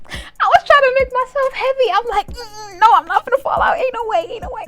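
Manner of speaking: high, loud comic panic; panicked denial. Retold or imitated. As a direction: insist frantically, as if bracing against a fall. Acting out panicked gravity struggle.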